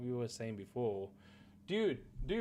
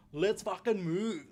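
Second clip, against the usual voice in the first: mock Australian accent, higher and slow; mocking Aussie line; 6 semitones higher than usual. Streamer shows how not to say Geralt's line, in a mock Australian accent. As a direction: drawl it like an Australian action hero, mocking. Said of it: "you don't say it like that".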